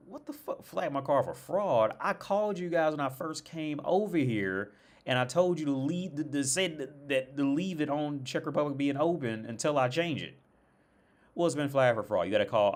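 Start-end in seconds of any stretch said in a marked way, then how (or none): 0.1-3.8 much higher, disbelieving, dragging out the second line; incredulous outburst. Retold or imitated. Caller hearing his card was flagged, higher and incredulous. His outraged reply to the bank. 11.3-12.7 louder, matter-of-fact customer-service tone; bank rep voice. Retold or imitated. Bank rep telling him to call Visa, louder and matter-of-fact.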